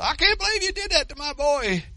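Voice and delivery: upset mother. Acted. Mother scolding the boy who made her son swim, high and loud. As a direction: scold in a shrill rush of disbelief.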